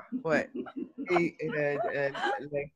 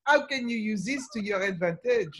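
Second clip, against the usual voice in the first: French accent; 4 semitones higher, 5 dB louder than usual. Shown as a demonstration. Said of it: "I love to speak like this".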